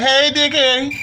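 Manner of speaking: high loud cheery greeting; flubbed joke. Retold or imitated. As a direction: botch the greeting on purpose. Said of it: "I fucked that joke all the way up".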